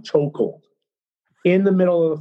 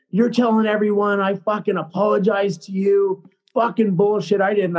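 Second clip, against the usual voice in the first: higher, heated accusation; indignant retold outburst, pitched up; confrontational coach; 5 semitones higher than usual. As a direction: imitate a coach angrily coming at a player. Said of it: "he starts fucking coming at me".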